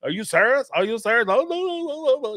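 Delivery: high, insistent "no, no, no" imitation; hammed-up evasive denial; mock Suki dodging. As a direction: pitch way up and play dumb. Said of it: "pretend like she don't understand English".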